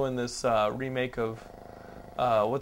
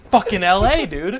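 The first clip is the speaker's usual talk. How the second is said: exasperated exclaim; 5 semitones higher, 10 dB louder than usual. Retold or imitated. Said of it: "and I'm like".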